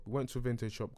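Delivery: normal talking voice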